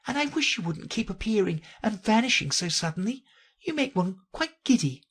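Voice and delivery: high character voice, politely reproachful; Alice complaining. Acted.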